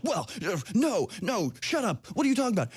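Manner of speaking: mock them getting caught out and squirming, climbing in pitch; flustered protester. Host mimics women caught out by his question, flustered and rising high. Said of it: "suddenly they're like".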